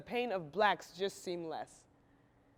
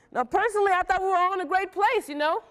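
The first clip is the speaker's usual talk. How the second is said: higher and louder, bright eager pledge cheer; chipper enthusiasm; 9 semitones higher, 7 dB louder, faster than usual. Acted. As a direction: sell it like the happiest patient in the ward. Chipper recollection of sorority feeling.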